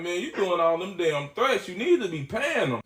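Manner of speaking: normal talking voice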